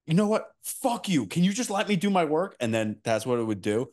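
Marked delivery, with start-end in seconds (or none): 0.0-2.5 mock snappy assistant. Retold former assistant snapping back at the agent. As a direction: play the fed-up assistant snapping at her boss. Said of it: "his last one would be like".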